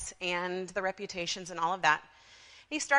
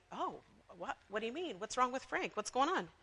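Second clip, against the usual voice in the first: concerned listener; 2 semitones higher, 6 dB quieter than usual. Acted. Listeners' worried reply, acted.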